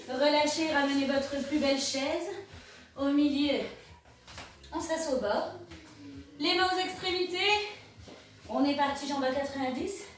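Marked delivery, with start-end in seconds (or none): none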